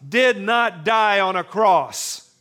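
loud, high, rousing sermon build; fervent pulpit crescendo; preaching at full force. Acted. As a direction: preach it, lift the room.

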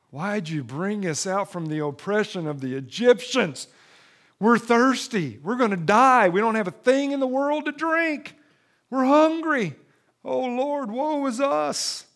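high, loud, hammed-up whining complaint; whining Israelites. The thirsty Israelites complain to Moses, whining. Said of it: "they start whining".

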